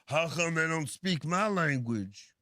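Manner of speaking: slow, drawn-out mock complainer; grumpy man yelling at clouds. Host mocks commentator as complaining man, slow crank voice. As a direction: grumble slowly like a put-on crank. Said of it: "coming off like man yelling at clouds".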